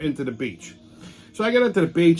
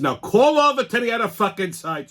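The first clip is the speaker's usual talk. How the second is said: mock angry demand; 7 semitones higher, 4 dB louder than usual. Demanding clerk call other side.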